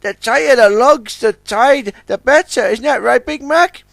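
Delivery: keen youngster asking his elder; young tug Sunshine. Acted. Sunshine asks Big Mac, high and eager.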